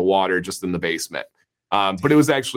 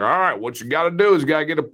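fast-talking Southern how-to guy; Southern accent; 5 semitones higher, faster than usual. Acted. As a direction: imitate a Southern handyman explaining a fix. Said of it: "with a real Southern accent".

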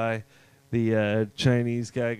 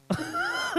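squeaky huh; 5 dB quieter than usual. Acted. Joking aside about [name].

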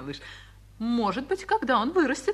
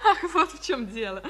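laughing; 4 semitones higher than usual. Acted. Laughing realisation.

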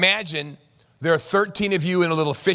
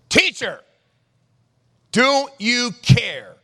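loud, high, drawn-out yell; 8 semitones higher, 7 dB louder, much slower than usual. Acted. Disciples waking Jesus in the storm, desperate yelling. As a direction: shout slowly over a storm, pitch way up. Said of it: "they're yelling".

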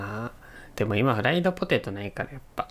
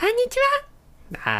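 put-on high cute anime girl voice; squeaky cute anime girl greeting; moe voice; much faster than usual. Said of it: "もう一回行こう".

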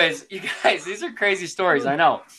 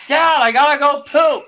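put-on kid calling out; 9 semitones higher, 5 dB louder than usual. Kid interrupting adults.

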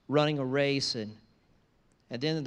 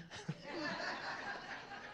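airy amused laugh, pitched high and soft; a quick unvoiced chuckle; soft amused laugh at mom's line; breathy high laugh; 10 dB quieter than usual. Real, not acted.

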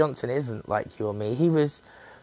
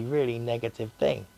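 ordinary delivery

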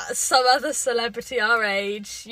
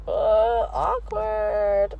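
drawn-out awkward; much slower than usual. Retold or imitated. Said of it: "so I was like".

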